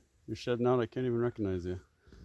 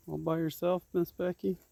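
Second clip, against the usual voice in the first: high, louder coo to an animal; sing-song pet talk; 9 semitones higher, 5 dB louder than usual. Real, not acted. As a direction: call her like a pet, high and bright. Calling sweetly to a donkey.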